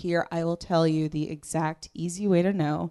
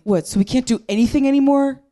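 high, fast indignant man's whine; mock a man outraged he can't do anything; whiny guy; 8 semitones higher, 6 dB louder than usual. Comic mocking a complaining man, high whine. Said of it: "I heard this guy saying".

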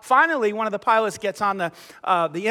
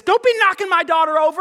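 high, indignant mock yell; yelling mother; 9 semitones higher, 4 dB louder than usual. Mother scolding a fellow passenger, yelling in imitation. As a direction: imitate an angry mom telling someone off. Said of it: "she is yelling at me".

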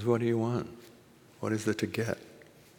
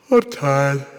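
sharp intake, then loud high outburst; caustic teacher; 6 semitones higher, 11 dB louder than usual. Retold or imitated. Raven's answer to Badger, caustic exasperated outburst. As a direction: gasp and snap it out, acted exasperation. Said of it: "caustic, you know, no effing around teacher".

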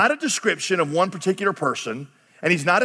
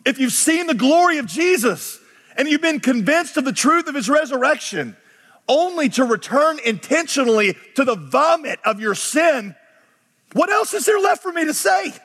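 raised, high-pitched exasperated appeal; exasperated, bewildered; 7 semitones higher than usual. Retold or imitated. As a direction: plead in disbelief, pitch climbing high. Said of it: "He's exasperated, almost bewildered".

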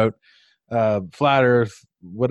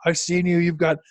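mock accuser; 5 semitones higher than usual. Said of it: "he's like".

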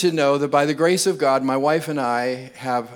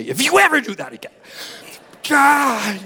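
mock stern threat; 9 semitones higher, 4 dB louder than usual.

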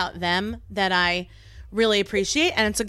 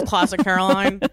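ordinary talk, nothing marked

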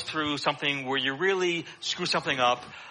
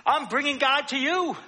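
emphatic proclamation; 9 semitones higher, 3 dB louder than usual. Real, not acted. Climax of sermon.

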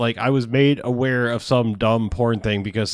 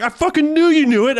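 mocking friend; 13 semitones higher, 4 dB louder than usual. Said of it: "he was like".

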